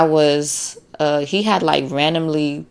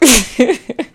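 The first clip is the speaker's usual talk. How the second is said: high amused laugh; a quick high burst of laughter; 9 semitones higher than usual. Real, not acted. Vlogger laughing at her own gloating.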